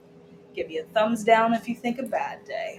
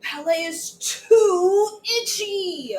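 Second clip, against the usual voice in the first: slow, high whiny complaint; whining; 8 semitones higher, slower than usual. Acted. Child complaining to her ballet teacher, slow whiny cry. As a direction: whine like a cranky child. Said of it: "I said".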